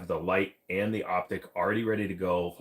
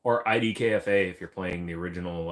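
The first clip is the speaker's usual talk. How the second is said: ordinary talk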